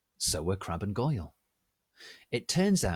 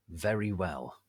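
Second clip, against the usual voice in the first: plain reading voice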